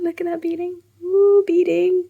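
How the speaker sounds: high, thrilled squeal over beading; excited. Real, not acted. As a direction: cheer the beading on. Showing off finished beading.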